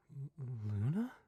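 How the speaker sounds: slow, shaky, stammered question; shaking voice. A narrator's reading. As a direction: stammer it out in disbelief. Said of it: "said in a shaking voice".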